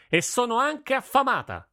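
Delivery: high, loud shout across the room; girl shouting home to her father; loud call. Acted. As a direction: call out over the din of the forge. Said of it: "gridò a gran voce".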